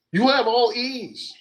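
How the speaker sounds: high, slow disbelief; can't believe it; incredulous. Retold or imitated. Incredulous other voice.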